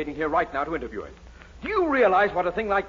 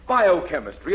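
ordinary talk

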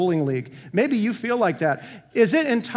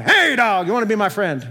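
mock yell at a dog; 2 semitones higher, 6 dB louder than usual. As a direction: bark it out like yelling at a stray. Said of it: "don't yell at the dog".

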